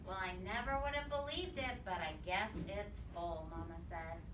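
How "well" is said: ordinary talk, nothing marked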